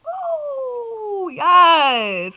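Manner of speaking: high ooh, then a loud yes; excited ooh yes. Real, not acted. To her viewers, a high ooh then a loud yes. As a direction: burst out in joy.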